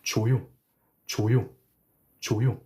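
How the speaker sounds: 조요 said clearly, one syllable at a time; model pronunciation. Shown as a demonstration. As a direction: model 조요 for students to copy. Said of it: "attaching the U ending".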